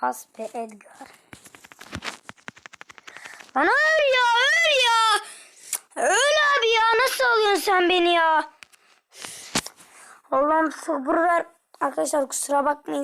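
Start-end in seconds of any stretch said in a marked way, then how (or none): 3.6-8.5 high, loud furious shouts; angry yelling. Real, not acted. Raging at the enemy who keeps escaping. 10.3-11.4 exasperated prayer. Real, not acted. Praying for patience after rage.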